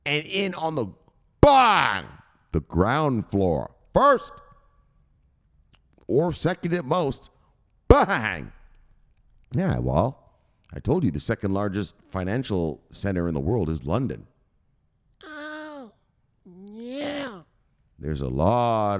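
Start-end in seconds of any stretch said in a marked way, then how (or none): 0.0-4.3 high loud bang, then ground floor first dragged slowly; slow emphatic repeat. Shown as a demonstration. Vlogger drilling the UK quote into viewers, slow stress. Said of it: "let me bang this again". 15.2-17.4 falsetto oh yeah. Pretend. Mock-falsetto exclamation.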